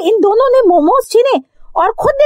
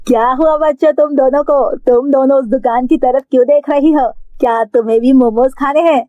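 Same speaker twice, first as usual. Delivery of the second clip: much lower, gentle big-sister voice; kind older sister voice; 12 semitones lower than usual. Acted. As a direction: speak as the friendly didi offering momos. Didi speaks to the girls. (बड़ी दीदी की धीमी, भारी आवाज़)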